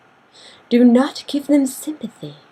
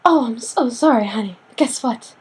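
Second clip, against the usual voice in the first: melodramatic; 2 semitones higher than usual. Acted. Turning down a fake friend, mock melodramatic. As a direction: overact the wounded apology. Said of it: "you can be all melodramatic about it".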